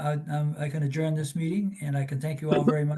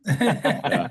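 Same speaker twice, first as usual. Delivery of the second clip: laughing; 7 semitones higher, 6 dB louder than usual. Real, not acted. Laughing at pilot voice joke.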